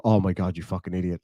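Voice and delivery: low, muttered mock scolding; self-mocking. Laughing at own mistakes, self-mocking. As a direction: chide yourself under your breath, half joking.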